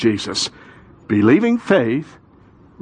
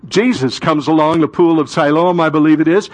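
ordinary talk, nothing marked

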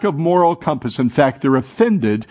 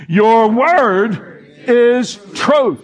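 boomed scripture; 8 semitones higher, much slower than usual. Shown as a demonstration. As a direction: boom the verse out. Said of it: "say it again".

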